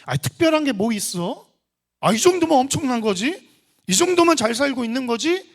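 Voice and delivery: loud, high rhetorical questions; imitated worldly complacency; mock self-satisfaction. World's deceptive questions acted out.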